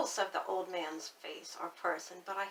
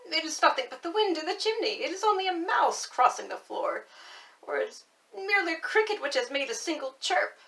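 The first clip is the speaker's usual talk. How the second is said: quick, higher, anxious self-reassurance; self-comforting old man; 7 semitones higher, 7 dB louder, faster than usual. Acted. Old man in bed reassuring himself, quick and higher. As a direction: talk yourself out of the fear, fast. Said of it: "trying to comfort himself".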